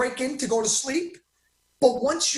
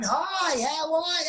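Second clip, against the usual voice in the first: a lightly higher, friendly greeting in Kenny's voice; Kenny's way of talking; 5 semitones higher, slower than usual. Retold or imitated. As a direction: imitate the old friend saying hi, only slightly changed. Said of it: "That's the way he used to talk".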